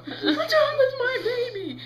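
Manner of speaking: high, slow, loud mock wail; wailing mother. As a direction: play a hysterical mom, drawn out. Same mother's cry repeated.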